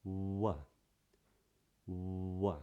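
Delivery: "W" as a drawn-out oo popping open into wuh; rounded W sound. Shown as a demonstration. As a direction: hold rounded lips for "W", then pop them apart. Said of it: "lips just sort of open up, they pop apart".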